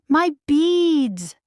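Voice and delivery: high, loud furious shriek. A narrator's reading. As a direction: shriek at the stolen beads. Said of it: "Drizella shrieked".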